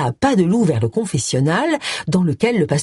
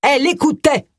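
sour accusation; 9 semitones higher than usual. Acted. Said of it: "affirma aigrement un homme maigre".

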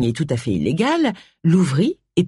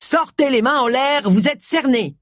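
loud voice; 8 semitones higher than usual. A narrator's reading. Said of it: "une voix forte qui disait".

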